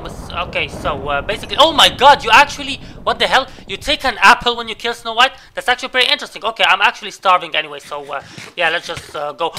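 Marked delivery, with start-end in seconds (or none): none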